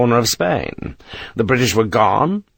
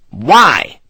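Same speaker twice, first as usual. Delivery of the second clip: loud, sharp single word; barked; 6 semitones higher, 7 dB louder than usual. Acted. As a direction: bark it back at her. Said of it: "Sharp barked the word".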